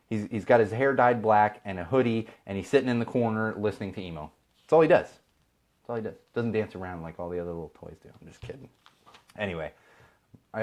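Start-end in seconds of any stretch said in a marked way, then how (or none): 4.6-5.1 higher and louder, hammered out; punchy exclamation. Acted. Repeats line two ways. 5.1-6.2 much slower and softer, dragged out; slow deadpan repeat. Acted. Repeats line two ways.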